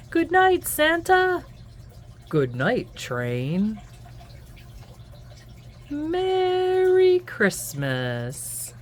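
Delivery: lively sing-song picture-book read-aloud; reading to children. A narrator's reading. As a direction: read it to little ones at bedtime.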